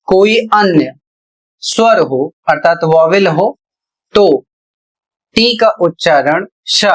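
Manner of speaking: normal talking voice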